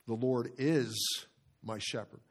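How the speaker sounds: slow, with IS drawn out and punched; stress on IS. Shown as a demonstration. Pastor showing his verse study, stress on IS. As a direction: hang on "is" before finishing. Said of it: "emphasizing each word".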